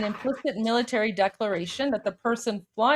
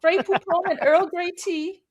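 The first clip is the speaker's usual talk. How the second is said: higher, hammed-up British accent; mock British accent; 8 semitones higher than usual. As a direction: ham up a fake British accent. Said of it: "my accent is British approved".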